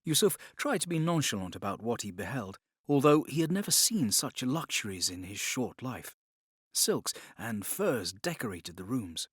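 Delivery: usual speaking voice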